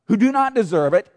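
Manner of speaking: usual speaking voice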